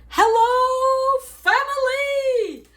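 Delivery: high, loud, stretched-out joyful hello; joyful greeting. Shown as a demonstration. Greeting the family, overflowing with joy of the Lord. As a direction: burst in bubbling over with joy. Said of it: "where you're like".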